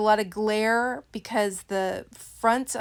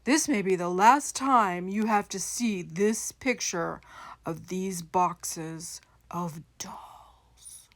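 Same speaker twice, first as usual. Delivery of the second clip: mock-dire warning fading to a slow whisper; mock ominous warning; 2 semitones lower, slower than usual. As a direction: slowly fade out into a spooky hush.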